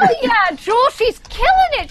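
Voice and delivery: high, loud gleeful cheer with a laugh in it; excited cheering. Acted. DJ cheering on her friend's success, excited.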